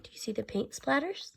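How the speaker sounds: usual speaking voice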